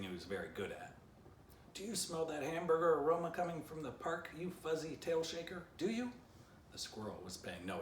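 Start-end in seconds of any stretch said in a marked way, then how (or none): 1.7-6.1 higher, louder taunt rising at the end; taunting shout. A narrator's reading. Stick Dog jeers at the squirrel, shouting higher. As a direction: jeer up at the squirrel. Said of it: "Stick Dog continued shouting".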